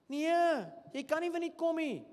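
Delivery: high, mocking voice of a freeloader; mock whiny critic. Preacher mocks critics who want free events, high and mocking. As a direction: parody the person who wants everything free.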